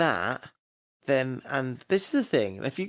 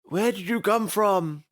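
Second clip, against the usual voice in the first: slightly put-on, slow, raised quote; quoted character line; 5 semitones higher, slower than usual. Quoting the end of the episode, put-on character voice. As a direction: lift and stretch it like the character. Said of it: "at the end where they said".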